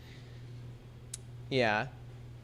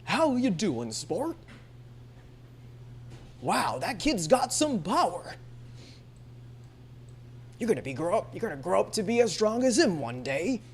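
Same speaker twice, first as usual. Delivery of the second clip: put-on round, rolling old man voice, higher than usual; caricatured old fellow, back-of-the-mouth rolling; old male anime voice; 5 semitones higher, 4 dB quieter than usual. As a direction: roll the words in the throat like a cartoon old man. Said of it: "The old male voices".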